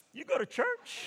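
high, laughing disbelief; can't believe his friend goes to church; incredulous. Retold or imitated. Surprised at his friend going to church, high laughing disbelief.

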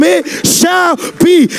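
drawn-out, high, shouted cry; slow shouted proclamation to the church; shouted declaration. Shown as a demonstration. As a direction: call it out for the room to repeat. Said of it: "Touch somebody and tell them".

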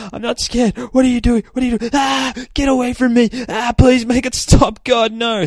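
very high, loud hammed-up terror; squealing, frantic acted freak-out; panicked screaming. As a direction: scream and plead in mock panic. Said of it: "he just starts screaming".

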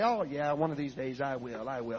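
low, soft imitation of a reluctant man; dismissive putting-off. Husband refusing to yield, low noncommittal mumble. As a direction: brush it off without commitment.

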